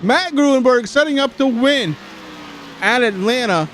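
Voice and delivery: excited race call, high and loud; live play-by-play. Real, not acted. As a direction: call the finish like a race announcer.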